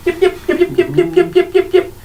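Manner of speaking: high, tiny kobold yips; yipping kobolds. Acted. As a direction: yap like a pack of little kobolds. Kobolds rallying each other.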